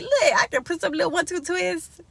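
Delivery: high, quick bragging voice; proud. Retold or imitated. Vlogger bragging about her styled hair, high quick proud voice. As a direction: show off the little twists. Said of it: "I'm like".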